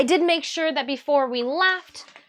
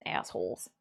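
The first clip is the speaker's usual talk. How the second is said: muttered whisper under the breath; 13 dB quieter than usual. Real, not acted. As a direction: whisper it as an aside. Grumbling at cats aside.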